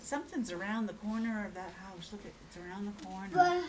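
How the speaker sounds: very soft, low warning; hushed suspense. Acted. Reader to child as she nears the corner, hushed. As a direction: keep it hushed and secretive.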